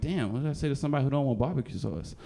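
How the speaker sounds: ordinary delivery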